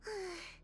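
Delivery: long tired sigh before speaking; fed-up exhale; weary sigh. Acted. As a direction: sigh like you've heard it all before. Weary of same old reports.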